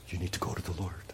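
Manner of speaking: hushed, urgent repeat; whispered plea. Real, not acted. Pastor pleading softly with the congregation, whispered. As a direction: lean in and whisper it.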